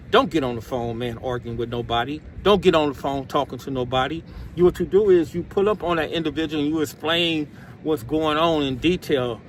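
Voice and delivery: normal talking voice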